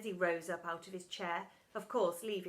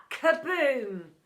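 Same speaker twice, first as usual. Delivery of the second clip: loud booming kaboom; loud explosion noise; 3 semitones higher, 9 dB louder than usual. A narrator's reading. Acid explosion in class, loud kaboom. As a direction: shout it like a blast.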